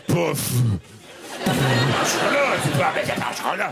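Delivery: put-on lightly French-accented fumbling, "c'est pas un chocolat" repeated; hesitant mock-French grumble; French muttering. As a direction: stammer the French as if stuck for words. Flustered French mumbling about pastry.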